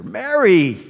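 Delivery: loud joyful name call; laughing call. Acted. Jesus revealing himself to Mary, laughing. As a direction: burst her name out laughing. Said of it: "with laughter in his voice".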